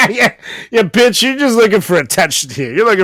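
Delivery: high, loud laughing jeer; laughing mockery. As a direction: cackle it out, mocking. Mocking the celebrity, laughing.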